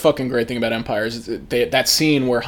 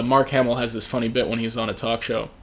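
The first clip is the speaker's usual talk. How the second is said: usual speaking voice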